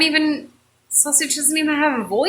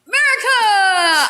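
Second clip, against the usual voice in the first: slow, louder cry of the name; patriotic shout; 8 semitones higher, 5 dB louder, slower than usual. Acted. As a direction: bellow it like a rally chant. Sausage cheers again.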